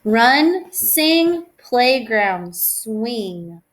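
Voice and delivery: sing-song rhyming read-aloud; reading to children. Acted. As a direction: bounce the rhyme for little kids. Reading a rhyming picture book.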